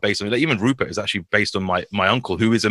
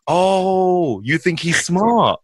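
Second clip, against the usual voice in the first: incredulous; 6 semitones higher, much slower than usual. Retold or imitated. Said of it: "And I'm like".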